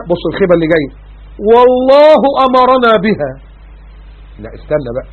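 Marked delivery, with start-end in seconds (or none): 1.5-3.3 Quranic recitation. Acted. Preacher chanting the verse, slow and melodic. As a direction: draw the phrase out like chanted scripture.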